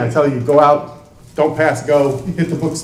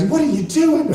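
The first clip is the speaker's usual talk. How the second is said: wife voice; 11 semitones higher than usual. Retold or imitated. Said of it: "My wife will come down the stairs".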